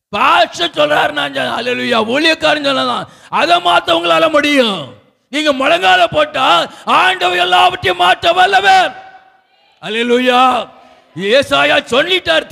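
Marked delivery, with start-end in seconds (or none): 3.3-8.9 high, sustained preaching cry; rousing proclamation. Real, not acted. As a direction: proclaim God's power to the congregation. Preaching climax.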